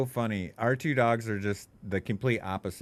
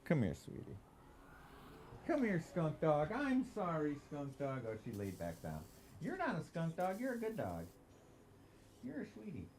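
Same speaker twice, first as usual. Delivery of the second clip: reassuring the dog; 8 semitones higher, 9 dB quieter than usual. Real, not acted. Owner soothing his upset dog, soft high baby talk. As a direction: coo softly to soothe her, pitched way up. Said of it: "I have to reassure her".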